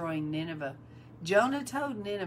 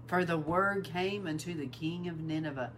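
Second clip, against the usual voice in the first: ordinary talk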